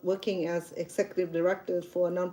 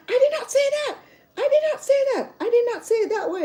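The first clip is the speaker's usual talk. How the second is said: imitating her mom's shrill-high protest, much higher and louder; mom's high denial; 5 dB louder than usual. Daughter mimics mom denying what the video caught, very high. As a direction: squeal a panicked denial, fast by the end. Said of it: "So I played the video for her".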